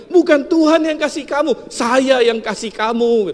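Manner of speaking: loud, high boast, slowing at the end; gloating boast. Retold or imitated. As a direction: rub it in slowly. (membual keras lalu melambat)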